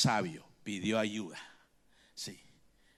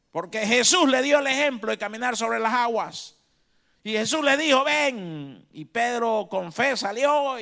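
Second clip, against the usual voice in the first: loud, high-pitched fiery sermon; booming pulpit preaching; preaching at full force; 9 semitones higher, 13 dB louder than usual. Acted. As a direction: preach it out to the whole church. Preaching the gospel.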